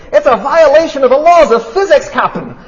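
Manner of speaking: high, loud, hammed-up Scottish engineer; bad Scottish accent shouted up from the engine room; Scotty protesting in a comic Scottish accent; terrible Scottish accent. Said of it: "I do a terrible Scottish accent".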